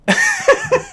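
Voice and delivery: loud, very high laugh; sudden real cackling laugh; burst of laughter. Real, not acted. Reader mocks the filing's magazine source, laughing hard.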